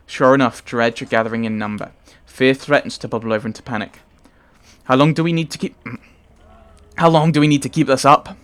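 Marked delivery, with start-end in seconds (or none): 4.8-8.4 put-on louder anxious Scottish-accented question; mild worried Scottish voice. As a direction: ask it urgently in a Scottish accent. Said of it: "Rook's Scottish".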